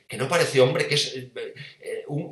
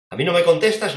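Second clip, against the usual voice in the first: indignant challenge; 5 semitones higher, 6 dB louder than usual. Acted. Pilato confronts silent Jesus.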